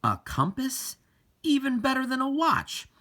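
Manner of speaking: light up with wonder at the find; delighted boy. Acted.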